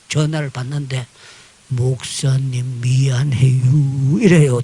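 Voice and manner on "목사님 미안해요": slow Chungcheong drawl, low; Chungcheong dialect. Retold or imitated. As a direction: lightly imitate a Chungcheong farmer. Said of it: "충청도 말로".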